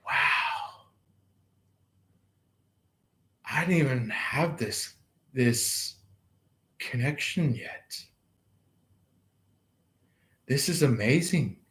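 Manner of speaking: soft, breathy wonder at waking; hushed amazed murmur; hushed awe. Pretend. Imagined awestruck morning reaction.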